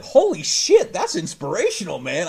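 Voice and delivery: very high loud exclamation; mock amazement. Imagining being wowed by backflips. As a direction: shout it out in awe. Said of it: "I'd be like".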